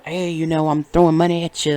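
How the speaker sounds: loud, brash show-off quote; mock big spender. Mocking a man flashing cash at a woman, loud boasting. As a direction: boast it loudly, rushing the end.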